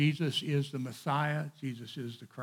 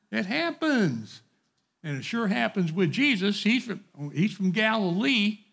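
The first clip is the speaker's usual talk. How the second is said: higher and louder, driving the point home; fervent preaching; 6 semitones higher, 9 dB louder than usual. Real, not acted. Preacher telling his class not to put people down. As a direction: lift up the average person.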